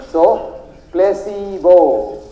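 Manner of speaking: placebo, stretched very slowly; drawn-out placebo. Shown as a demonstration. Doctor landing his point to the audience, very slow. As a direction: draw out placebo as the big reveal. Punchline word of the lecture.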